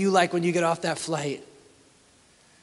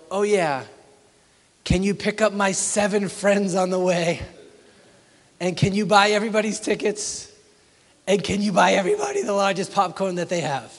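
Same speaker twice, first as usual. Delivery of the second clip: put-on higher, louder pushy teen voice; 4 semitones higher, 5 dB louder than usual. Teenager piling requests onto dad, higher and louder. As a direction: pile on each demand eagerly.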